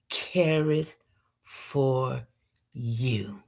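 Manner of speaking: slow, hushed poetry reading; poem recitation. Acted. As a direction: recite each word with pauses.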